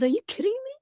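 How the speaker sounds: high, incredulous son voice; son's disbelief. Retold or imitated. Bonus son brushing off his stepmother's fear, high incredulous. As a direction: pitch it way up in disbelief. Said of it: "and he says".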